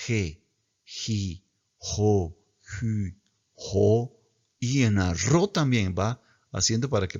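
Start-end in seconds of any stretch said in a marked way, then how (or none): 0.1-4.2 "ji", "jo", "ju" with a breathy h sound; rough breathing. Shown as a demonstration. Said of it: "como si fuera una J".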